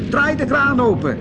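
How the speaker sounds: high, loud call across. Acted. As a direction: shout the order to your sister. Flits calls to Glitter.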